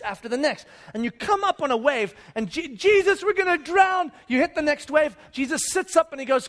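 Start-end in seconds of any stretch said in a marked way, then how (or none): 2.5-4.1 high, urgent cry for help; frightened, raised voice; panicked cry. Acted. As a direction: cry out as the boat goes under. Disciples panic in storm.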